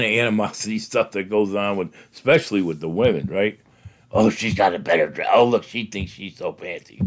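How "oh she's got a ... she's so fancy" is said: lightly voiced gossip in a lower pitch; a hint of a low, sniping gossip; catty gossip. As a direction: quote a catty gossip, dropping the voice. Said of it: "That's how gossip starts".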